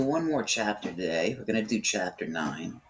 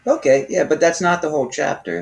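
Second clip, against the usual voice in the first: raised voice, interrupting; louder; 6 semitones higher, 10 dB louder than usual. Real, not acted. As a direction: cut in loudly to correct. Correcting the reader, raised voice.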